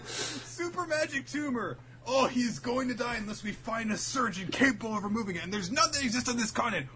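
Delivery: high-pitched melodramatic lament; hammed-up doom announcement; mock alarm. Parody of characters' panic.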